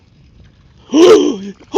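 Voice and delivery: high loud shocked gasp; sharp gasp. Real, not acted. Sharp gasp as he loses the fish.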